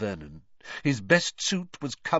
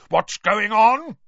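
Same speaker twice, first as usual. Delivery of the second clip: slow, loud shout, raised in pitch; angry demand; 10 semitones higher, 9 dB louder, slower than usual. A narrator's reading. Uncle Vernon demanding answers from his nephew, loud and angry. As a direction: drag it out loud and furious. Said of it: "growled Uncle Vernon".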